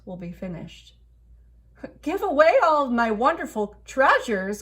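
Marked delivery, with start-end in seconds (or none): 2.0-4.6 loud, high outraged cry. A narrator's reading. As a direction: cry it out in disbelief. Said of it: "cried the king".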